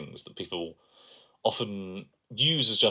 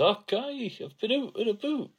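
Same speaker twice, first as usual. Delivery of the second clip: very high-pitched mock Scottish accent; bad Scottish accent. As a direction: squeak out an awful Scottish accent. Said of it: "[name]'s actual Scottish accent".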